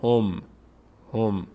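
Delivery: هم as one closed syllable, hom; correct pronunciation. Shown as a demonstration. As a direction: close هم firmly on a still m.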